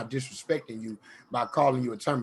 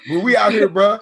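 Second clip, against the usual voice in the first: higher and louder, all-in homie voice; loud bro talk; 6 semitones higher, 9 dB louder than usual. Retold or imitated. Telling Matt about grinding, loud bro voice. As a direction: commit to the bit loudly. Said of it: "I'm like".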